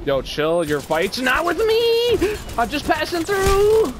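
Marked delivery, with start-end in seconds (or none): 1.2-2.4 high, loud, slow pleading yell. Real, not acted. Yelling at enemies in game. 2.6-3.9 yelling. Real, not acted. Yelling at enemies in game.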